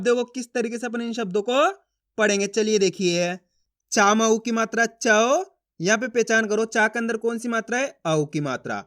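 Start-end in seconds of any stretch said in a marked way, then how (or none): 3.9-5.4 slow chant, चौ stretched on a rising glide; syllable spelling drill. Shown as a demonstration. Teacher spelling a word for learners, drawn-out and rising. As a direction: spell it out, then hold the au of चौ upward. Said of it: "चा का उच्चारण कैसे करेंगे".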